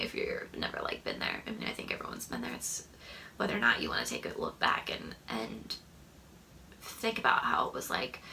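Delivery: whisper. Real, not acted. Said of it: "trailing into whisper".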